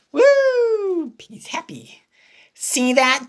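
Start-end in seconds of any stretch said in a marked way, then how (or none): 0.1-1.1 high breathy cheer; whispered woo. Acted. A friend cheering in a whisper.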